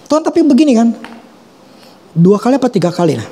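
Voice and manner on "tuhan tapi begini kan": higher, insistent retort; arguing back. Retold or imitated. Preacher retells arguing with God, insistent and higher. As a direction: talk back to God stubbornly. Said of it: "saya bantah".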